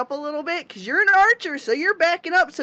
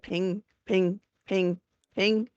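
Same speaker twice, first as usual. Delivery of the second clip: soft pinging; 3 semitones lower, 6 dB quieter, slower than usual. Retold or imitated. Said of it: "So then you're like".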